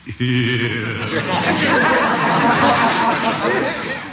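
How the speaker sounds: long, high rolling laugh; drawn-out high giggle; Gildersleeve's chuckle. Acted. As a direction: laugh at your own joke with relish. Uncle laughs at his own joke.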